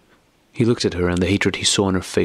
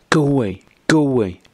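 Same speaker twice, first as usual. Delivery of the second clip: high, loud cry of pain; a wife crying out in pain at her husband; anguished cry; 7 semitones higher, 4 dB louder than usual. A narrator's reading. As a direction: cry it out as if it hurts physically. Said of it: "she cried, as if in physical pain".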